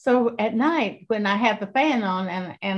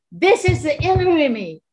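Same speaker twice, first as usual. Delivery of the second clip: ordinary talk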